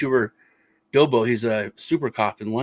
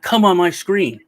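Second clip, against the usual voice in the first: mock yelled demand, higher and louder; raised-voice retold order; retold yelling; 5 semitones higher, 5 dB louder, slower than usual. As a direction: imitate yourself yelling in an argument. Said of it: "yelling at each other".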